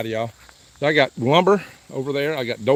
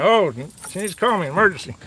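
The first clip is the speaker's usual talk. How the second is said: mock whiny; 6 semitones higher than usual. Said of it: "he's crying".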